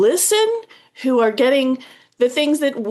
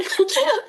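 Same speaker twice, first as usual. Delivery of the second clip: a short, bright burst of giggling; high giggle; 13 semitones higher than usual. Real, not acted. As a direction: giggle nervously as your turn comes. Giggle at own turn.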